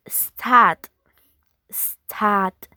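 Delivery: "stat" as staht, no r; British start. Shown as a demonstration. Teacher contrasting accents, British silent r. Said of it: "In British is".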